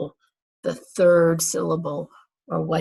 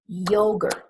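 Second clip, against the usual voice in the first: ordinary talk, nothing marked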